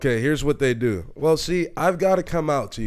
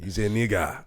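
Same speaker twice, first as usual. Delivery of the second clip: softer, lower African accent; 4 semitones lower, 5 dB quieter than usual. Shown as a demonstration. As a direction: say it in a Nigerian accent. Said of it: "now say it as an African".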